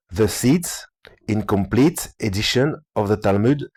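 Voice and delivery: slow, halting English with a French accent. Real, not acted. Reading the translator's English aloud, laboured French accent. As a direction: struggle through English word by word, French-accented. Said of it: "Mon accent anglais, ça va être beau".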